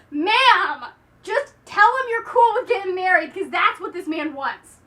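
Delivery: half-joking urging to the heroine; exasperated plea. Pretend. Reviewer urging heroine to marry the vicar, mock exasperated. Telling heroine to accept suitor.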